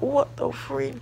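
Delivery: high startled exclamation; mock-startled freak-out; shocked. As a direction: blurt it out in disbelief. Shocked reaction in story.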